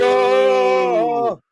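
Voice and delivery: loud high yell; shouted catchphrase. Retold or imitated. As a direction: belt it out like a wrestler.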